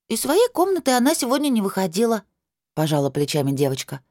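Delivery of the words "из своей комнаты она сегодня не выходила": put-on high child voice, shrugging; a small girl answering simply; little girl voice.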